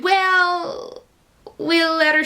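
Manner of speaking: high teasing quote; mimicking Hemsworth. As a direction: tease her from up high. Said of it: "And he said".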